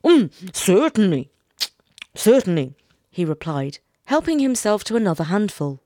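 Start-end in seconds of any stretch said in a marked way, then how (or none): 0.0-2.8 husband answering through food; reply hastily between mouthfuls; a little louder, words round a mouthful; mouth full. A narrator's reading. Husband replies while eating greedily.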